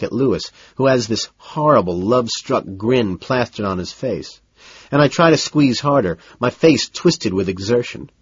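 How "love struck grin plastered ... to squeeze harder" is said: plain reading voice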